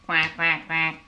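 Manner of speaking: put-on quacking low like a duck for the baby. Shown as a demonstration. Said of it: "What does a duck say?".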